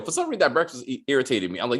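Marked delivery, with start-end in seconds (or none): none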